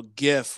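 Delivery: gif as GHIF, then as JIF; both gif pronunciations. Shown as a demonstration. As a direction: contrast the first sound of gif. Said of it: "where it's actually gif".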